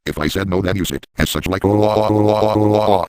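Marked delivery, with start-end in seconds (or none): 1.7-3.1 exaggerated louder mock moans; mock moaning. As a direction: moan it out loud as a joke. Moaning while using the dildo.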